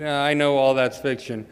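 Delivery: normal talking voice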